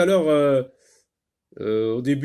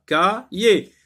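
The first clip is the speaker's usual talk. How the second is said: "Cahier" broken into two separate syllables; split into syllables; 4 semitones higher, 4 dB louder than usual. Shown as a demonstration. Teacher dividing the word for learners, "Cahier" syllable by syllable. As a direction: pause between the syllables of "Cahier". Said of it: "Deux syllabes".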